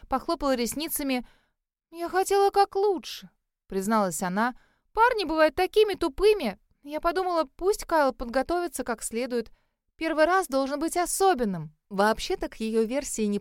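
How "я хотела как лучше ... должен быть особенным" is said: put-on chirpy gossip girl. A narrator's reading. Cheryl's defensive excuse.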